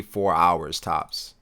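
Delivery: ordinary talk, nothing marked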